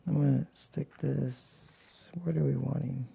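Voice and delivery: usual speaking voice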